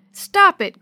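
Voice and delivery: high, loud "cut that out" outburst; sharp rebuke. Acted. Maria cutting off her brother's scary teasing, sharp rebuke. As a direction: shut him down, loud and high.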